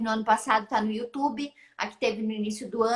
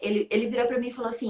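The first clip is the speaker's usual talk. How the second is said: usual speaking voice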